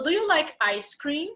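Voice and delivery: "ice cream" tipped upward to ask; rising question intonation. Shown as a demonstration. Teacher repeats the model question for students, rising on "ice cream". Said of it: "This is intonation for questions".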